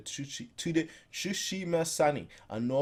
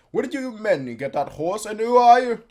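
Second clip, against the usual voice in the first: challenging soldier; 7 semitones higher, 8 dB louder than usual. Acted.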